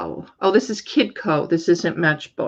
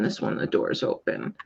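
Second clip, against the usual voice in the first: soft unvoiced aside to himself; whispered aside; 10 dB quieter than usual. Real, not acted. Seller whispering about car doors. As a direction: whisper it under the breath. Repeats remark under breath.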